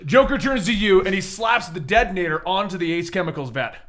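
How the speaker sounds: usual speaking voice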